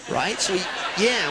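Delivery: ordinary talk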